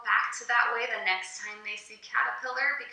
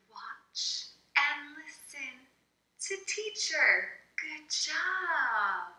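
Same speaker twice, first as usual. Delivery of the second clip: calming voice; slower than usual. Shown as a demonstration. Teacher gets student Elsa to focus, calming. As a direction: gently coax a child, with slow spaced words. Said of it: "you want that calming voice".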